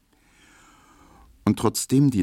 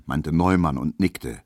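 usual speaking voice

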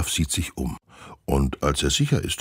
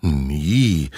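innocent protest; 4 dB louder than usual. Acted. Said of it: "versichern die Mädchen".

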